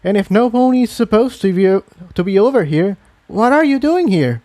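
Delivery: high mare voice, turning the question back; indignant young mare. Acted. Tiptoe challenges Thunderclap.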